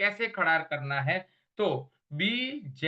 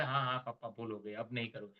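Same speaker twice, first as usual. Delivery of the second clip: very soft, lower, quick sorry from the son; meek apology; 7 semitones lower, 9 dB quieter, faster than usual. Retold or imitated. Son apologising to his father, meek and soft. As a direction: mumble the apology fast and small. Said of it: "बोले".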